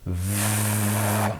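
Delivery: "v" as a long sustained buzz; isolated v sound. Shown as a demonstration. Teacher showing the starting noise, prolonged "v". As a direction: hold the sound "v" without naming the letter. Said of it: "Quel est le bruit que l'on entend au début?".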